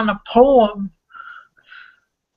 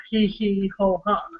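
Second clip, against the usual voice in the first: softer echo of hee hee ho ha; softer recited laugh; 4 dB quieter than usual. Acted. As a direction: quieter repeat of the chuckle. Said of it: "And it starts off as".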